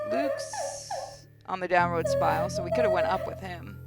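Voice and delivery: normal talking voice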